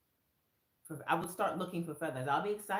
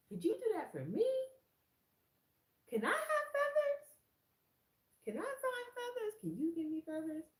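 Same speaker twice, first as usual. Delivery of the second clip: much higher, hopeful asking; pleading prayer. Retold or imitated. Asking God for feathers, high and hopeful. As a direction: beg like a child.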